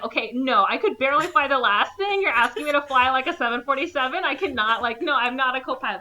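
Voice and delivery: panicked protest. Retold or imitated. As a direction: back out of it in a fluster. Gordon protesting he can't fly.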